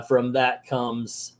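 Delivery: normal talking voice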